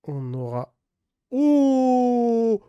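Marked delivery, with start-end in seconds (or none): 1.3-2.6 very high excited oh; a real burst of joy at the card; high, thrilled oh. Real, not acted. Spots a rare card.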